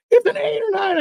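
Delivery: high, excited mock shout; thrilled imitation. Partner guesses his second fish's weight, thrilled.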